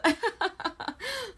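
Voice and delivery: laughing out loud at her own joke; loud high laugh. Real, not acted. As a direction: burst of high giggling.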